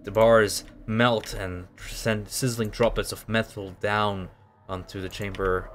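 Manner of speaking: usual speaking voice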